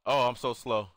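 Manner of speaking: ordinary talk, nothing marked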